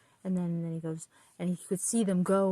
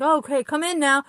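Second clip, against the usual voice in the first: higher, louder jolly welcome; a cheery odd host hauling someone in; jolly strange man; 6 semitones higher, 8 dB louder than usual. Retold or imitated. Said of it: "and he's like".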